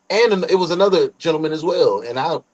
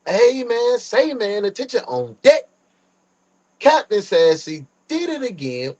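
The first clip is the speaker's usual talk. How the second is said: hype announcer; 8 semitones higher than usual. Acted. Streamer hype call to chat.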